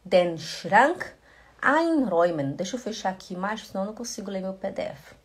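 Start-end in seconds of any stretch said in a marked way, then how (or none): 0.0-2.5 slow, clear German sentence; careful slow teaching delivery; slow demo. Shown as a demonstration. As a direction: spell it out slowly for students.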